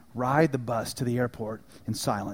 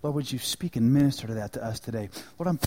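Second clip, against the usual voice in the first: closing prayer; 4 dB quieter than usual. Acted. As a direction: pray over the church gently. Pastor leads closing prayer.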